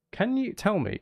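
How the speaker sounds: ordinary talk, nothing marked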